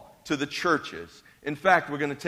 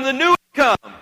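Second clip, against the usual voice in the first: louder and higher "The new has come."; loud call; 7 semitones higher, 8 dB louder, faster than usual. Shown as a demonstration. Pastor leading the congregation's call, raised voice. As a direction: call it out to the person behind you. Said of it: "tell them".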